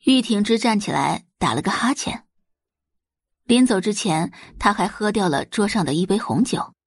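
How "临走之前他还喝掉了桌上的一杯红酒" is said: usual speaking voice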